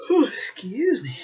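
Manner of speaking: words stretched through a real yawn. Real, not acted. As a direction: excuse yourself mid-yawn.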